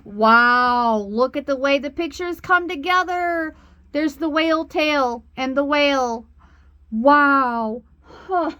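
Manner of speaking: amazed. Real, not acted. Reader sees the whale cards come together, amazed.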